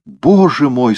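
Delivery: high, loud lament; dismayed. A narrator's reading. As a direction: cry out in mild theatrical dismay. Узнаёт о смерти кота, причитает. (сокрушённо)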